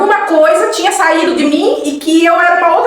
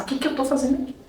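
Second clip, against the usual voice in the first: much softer, thinking-to-herself aside; private thought; 11 dB quieter, faster than usual. Retold or imitated. Newcomer asking herself why she came, hushed private thought. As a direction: drop to an under-the-breath inner voice. Só que eu pensava.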